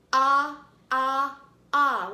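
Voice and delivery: AH said loud as short O in octopus; short O sound. Shown as a demonstration. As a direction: belt out AH like the start of octopus. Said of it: "hand motion with an octopus".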